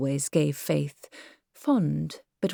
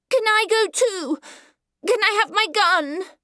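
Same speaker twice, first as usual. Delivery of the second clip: put-on a small boy calling out across the saloon; piercing high child's cry; 4 dB louder than usual. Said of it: "in piercing tones".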